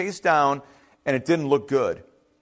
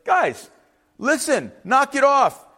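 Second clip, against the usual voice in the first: higher-pitched, get-their-attention scolding; exasperated Moses; 6 semitones higher than usual. Acted. Moses telling panicking Israelites to settle down, exasperated. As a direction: cut through the panic, pitched up. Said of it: "I don't know if he's saying it that way".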